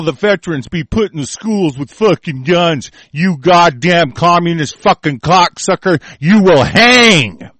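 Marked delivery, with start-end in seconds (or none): 6.2-7.5 drawn-out, heavy threat, each word slow; slow menacing threat. Real, not acted. Ranting at the military and traitors, slow menacing threat. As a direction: spell out the doom slowly and coldly.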